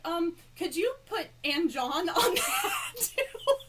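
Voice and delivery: embarrassed laughing request. Retold or imitated. Fan asking the actor to add her husband, embarrassed laugh. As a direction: stretch it out, cringing and giggling.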